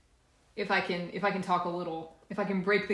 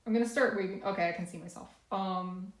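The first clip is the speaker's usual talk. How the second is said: ordinary talk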